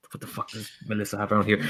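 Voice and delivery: fast whispered grumble; hurried whisper under the breath; muttered to self. Acted. Enid searching Melissa's kitchen for tea, fast whispered muttering.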